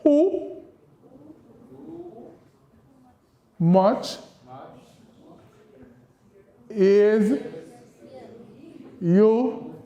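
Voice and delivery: Creole question spaced out one word at a time for the class to follow; slow classroom drill in Kittitian Creole. Shown as a demonstration. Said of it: "This is".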